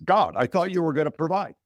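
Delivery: playing the drowned man's indignation, lightly; mock angry complaint. Joke teller voicing drowned man complaining to God, mild mock anger. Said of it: "he's really pissed off".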